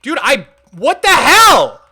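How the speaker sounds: loud, exasperated shout; real gamer rage yell; frustrated yell. Real, not acted. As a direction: blow up at the screen. Build deleted, gamer outburst.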